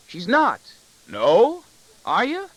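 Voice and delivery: put-on high, loud child's voice; little boy's high, excited back-and-forth. A narrator's reading. Boy answers father's mock alarm.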